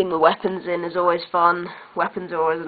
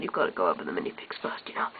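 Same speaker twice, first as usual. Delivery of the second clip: whisper; 13 semitones lower, 10 dB quieter, faster than usual. Acted. Aside after announcing minifigs.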